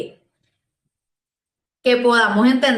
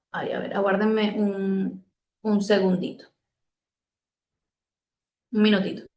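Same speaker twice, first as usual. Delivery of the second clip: quiet aside; 3 semitones lower, 6 dB quieter than usual. Real, not acted. Aside to someone at the door.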